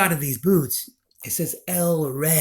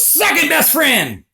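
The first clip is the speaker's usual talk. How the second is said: high, drawn-out retort; high-pitched emphatic comeback; calling out a correction; 8 semitones higher, 4 dB louder, slower than usual. Retold or imitated. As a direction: call it back over your shoulder, stretched out. Passerby turns and calls back correction.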